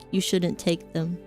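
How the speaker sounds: ordinary delivery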